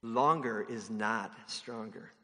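a low, deliberate reminder; slow and emphatic. Shown as a demonstration. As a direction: say it slowly to yourself. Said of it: "This one's for me".

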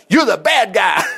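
shouted call across the yard; hollered out. Retold or imitated. Man shouting at a strange cat by the hot tub, loud holler. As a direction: yell it out at the cat. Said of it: "And so I hollered out".

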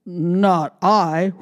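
gruff dog voice; barking dog voice. Acted. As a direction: bark it like a dog. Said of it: "barked the dog".

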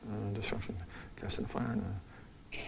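whispered aside over notes; murmuring to himself while checking his notes; muttered to self. Real, not acted. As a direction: mumble it under your breath, reading notes. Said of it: "Oh, I almost started preaching".

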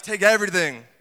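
a mother barking instructions; mild mock mom command; mom's order. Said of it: "My mom's like".

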